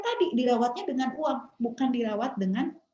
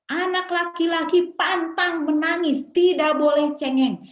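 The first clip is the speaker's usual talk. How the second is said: loud, high, drawn-out imitation of a scolding parent; stern parental command; 8 semitones higher, 6 dB louder than usual. Parent forbidding a son's tears, stern and raised. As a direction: lay down the rule loudly. Parent's rule voiced to a son.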